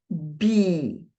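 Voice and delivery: Bee with a long drawn-out ee; long ee sound. Shown as a demonstration. Teacher shows the long ee of Bee to the students. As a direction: hold the ee in Bee. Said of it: "vai ser como em bee".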